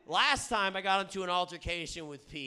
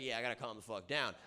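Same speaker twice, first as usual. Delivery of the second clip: low, soft, quick concession; acted meek agreement; 6 semitones lower, 6 dB quieter, faster than usual. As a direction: give in quietly and quickly. Voices his own grudging agreement.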